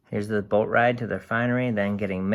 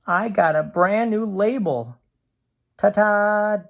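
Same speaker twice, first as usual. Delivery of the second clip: higher, bright showing-off voice; cheery ta-ta to the diary; proud and chirpy; 11 semitones higher, 7 dB louder than usual. A narrator's reading. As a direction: show off like a proud new can. Can shows off new label.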